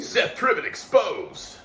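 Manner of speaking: high, slow hyped-up announcement; mock viral-video headline. Imagining their beating going viral online, mock hype. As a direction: call it out like a scandal headline.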